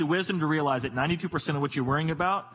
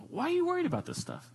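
gentle disbelief; 11 semitones higher, 4 dB quieter than usual. Acted. God gently questioning, like a parent.

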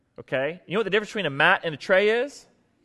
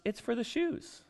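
calm reply; 7 semitones higher, 11 dB quieter than usual. Retold or imitated. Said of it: "She goes".

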